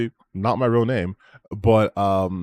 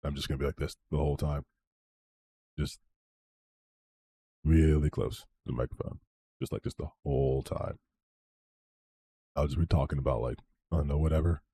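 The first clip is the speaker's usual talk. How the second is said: lower, softer sleepy moaning voice; 7 semitones lower, 6 dB quieter than usual. Shown as a demonstration. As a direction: drone it softly, close to the mic. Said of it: "so I have that, like, moaning voice".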